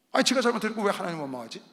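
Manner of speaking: usual speaking voice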